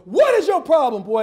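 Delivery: high, loud scolding shout; acted furious father; angry dad. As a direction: yell at your son across the field. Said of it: "his daddy ran on the field".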